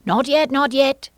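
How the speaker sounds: high, loud, urgent objection; hasty interruption. A narrator's reading. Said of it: "the rabbit hastily interrupted".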